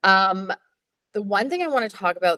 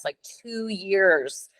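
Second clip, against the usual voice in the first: normal talking voice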